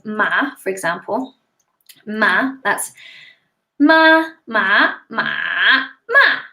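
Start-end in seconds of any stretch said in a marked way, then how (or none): 3.8-4.9 "ma, ma" first high and level, then rising; "ma, ma" as first and second tones; Mandarin tone demo. Shown as a demonstration. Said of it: "those are your four tones". 5.1-5.9 "ma" dipping low, then lifting; drawn-out low, dipping "ma"; Mandarin third tone. Shown as a demonstration. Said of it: "those are your four tones". 6.1-6.4 "ma" starting high and falling sharply; Mandarin fourth tone. Shown as a demonstration. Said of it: "those are your four tones".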